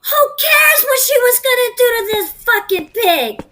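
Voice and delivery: high, loud contempt; angry outburst. Acted. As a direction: spit it out with disgust. Furious at a bloody betrayal.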